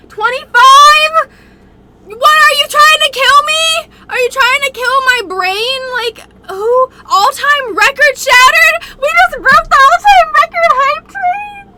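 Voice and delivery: high, loud, tearful disbelief; overwhelmed shock. Real, not acted. Streamer stunned by a record gift, high overwhelmed cry.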